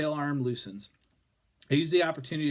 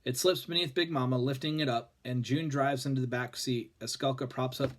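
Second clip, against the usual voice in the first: ordinary delivery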